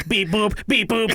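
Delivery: robot beeps, rising on each boop. Pretend. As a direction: beep like a lightly voiced robot. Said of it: "Accessing insult database".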